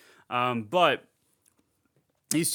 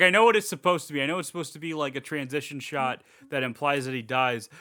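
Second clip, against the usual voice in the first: normal talking voice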